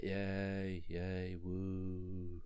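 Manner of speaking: half-hearted crowd cheering for Rocky; feeble cheer. Retold or imitated. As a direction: cheer like nobody cares. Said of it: "getting some cheers like".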